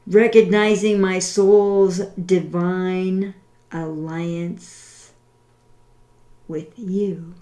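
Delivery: slow, deliberate, over-enunciated prayer. Shown as a demonstration. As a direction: pray each line slowly, with long pauses. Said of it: "I will say it slowly".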